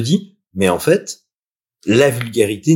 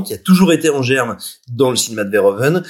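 usual speaking voice

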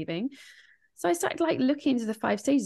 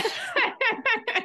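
high burst of laughter. Real, not acted. Laughing about swearing.

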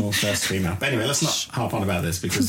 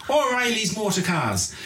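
slow, higher Irish advert voice; Irish ad accent; 10 semitones higher, slower than usual. Retold or imitated. Host mimicking Irish commercial in Irish accent. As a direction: sell it like an old Irish TV ad, slow and high. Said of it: "should I do the accent?".

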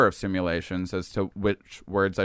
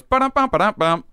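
high, louder sing-song ba-dum; vanishing sound; 10 semitones higher, 5 dB louder than usual. Retold or imitated. Describing perception vanishing, high sing-song ba-dum. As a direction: chant the ba-dum high like things popping away.

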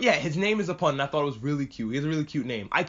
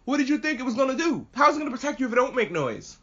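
high-pitched, indignant mock scolding; exasperated scolding; 8 semitones higher than usual. Scolding the kids left with the box, exasperated. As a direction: tell off silly kids in mock outrage, pitched up.

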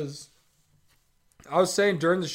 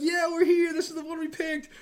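squeaky put-on voice, much higher; mock high squeak; 12 semitones higher than usual. Recapping the girls coming back to Yuna, mock high squeak. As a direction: mimic them in a silly high squeak. Girl group returning, retold mockingly.

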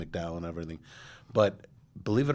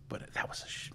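whispered aside; 10 dB quieter than usual. Real, not acted. Catching himself mid-swear.

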